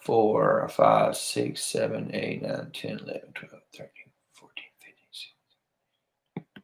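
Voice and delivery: counting down, fading to a whisper. Real, not acted. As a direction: let the count trail off into a whisper.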